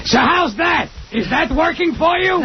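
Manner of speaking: imitating Gilbert mid-act; mock bedroom question. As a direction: squawk it out as a taunt. Mocking someone during sex.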